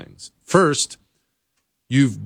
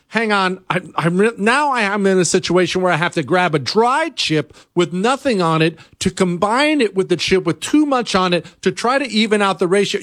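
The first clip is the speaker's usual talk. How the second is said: ordinary delivery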